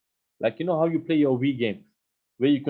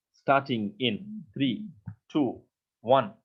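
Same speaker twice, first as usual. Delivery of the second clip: slow countdown; much slower than usual. Shown as a demonstration. Demonstrates the countdown text.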